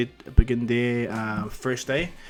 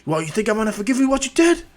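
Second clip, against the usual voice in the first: raised, high-pitched angry voice; hot-headed rival, high and loud; angry confrontation; 9 semitones higher, 6 dB louder than usual. Acted. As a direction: confront him loudly, outraged. Rival grabs hero and confronts him.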